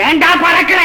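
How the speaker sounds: irritated shout. Acted. Father scolding his impatient son, irritated shout. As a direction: cut him off with an impatient yell.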